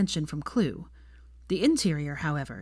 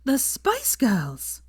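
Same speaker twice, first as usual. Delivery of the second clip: eager announcement; 7 semitones higher, much slower than usual. A narrator's reading. Drunk Hermione announcing.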